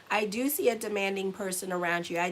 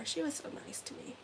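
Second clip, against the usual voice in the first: tearful whisper; 7 semitones higher, 11 dB quieter than usual. Real, not acted. Missing Karen Berg, near tears, breaking whisper. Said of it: "I'm gonna cry".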